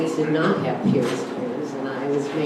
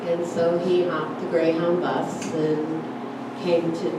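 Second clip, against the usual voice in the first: normal talking voice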